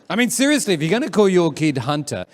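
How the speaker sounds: usual speaking voice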